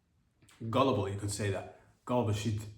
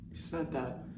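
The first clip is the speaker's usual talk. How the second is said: usual speaking voice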